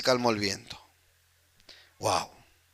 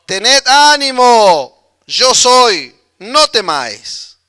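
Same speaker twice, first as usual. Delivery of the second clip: loud, slow, raised call; grito de Jesús; 4 semitones higher, 8 dB louder, much slower than usual. Acted. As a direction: shout reassurance across the water, drawing words out. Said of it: "él les gritó". (grito fuerte, lento y alargado)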